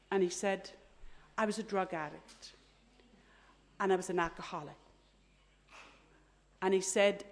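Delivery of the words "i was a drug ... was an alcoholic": low, soft, halting retold confession; tearful confession. Dying man confessing to a priest, subdued crying. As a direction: imitate a crying man's confession, understated. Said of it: "then he started to cry".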